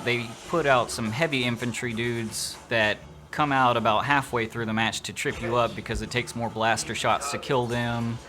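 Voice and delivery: ordinary talk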